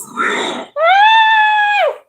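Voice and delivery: shrieking cackle, pitch way up; high shriek of a laugh; loud high shrieking laugh. Real, not acted. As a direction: let out a piercing squeal of laughter. Big laugh after joke.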